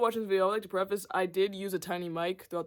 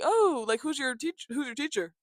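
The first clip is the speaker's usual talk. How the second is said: mock eager gymnast; 8 semitones higher than usual. Voicing other gymnasts.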